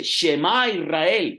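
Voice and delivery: high, loud and slow, calling out to the people; a commanding cry to pay attention; urgent call to listen. Acted. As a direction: call Shema Israel like a summons. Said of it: "en otras palabras, póngame en atención".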